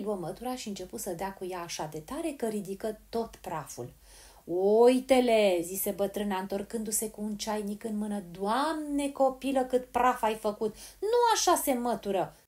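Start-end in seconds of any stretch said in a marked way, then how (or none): 4.4-5.6 very loud, stretched-out call; slow, loud cry of discovery. A narrator's reading. Granny returns, calls out triumphantly. 8.3-12.4 loud, higher-pitched granny's reproach; exclaiming, fussy telling-off; scolding. A narrator's reading. Granny scolds Polly over the dust.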